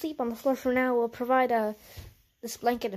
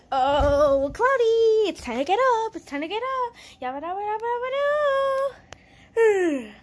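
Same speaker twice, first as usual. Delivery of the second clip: high sing-song wake-up call; gently waking a child; 8 semitones higher, 7 dB louder than usual. Acted. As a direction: coax a child out of bed in a lilting sing-song.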